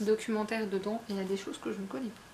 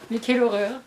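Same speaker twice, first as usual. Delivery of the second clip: a louder, hammed-up cry of horror; louder mock horror; 8 dB louder than usual. Booktuber to viewers repeating herself, louder mock outrage. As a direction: push the fake outrage up.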